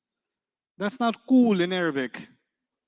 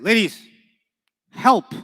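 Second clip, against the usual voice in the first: Ladies and help called out louder, help at the top like an order; louder, rising command to a group; 3 semitones higher, 6 dB louder than usual. Shown as a demonstration. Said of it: "How do I say it?".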